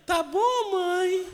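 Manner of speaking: mock child asking his mom meekly; scared little boy. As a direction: squeak it like a frightened kid.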